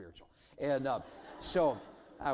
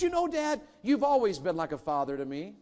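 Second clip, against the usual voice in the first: son's voice; 6 semitones higher, 6 dB louder than usual. Retold or imitated. Son's quote, much higher.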